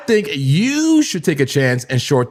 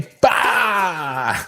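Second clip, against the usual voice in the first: excited shout; 4 semitones higher than usual. Real, not acted. Cheering crash, laughing.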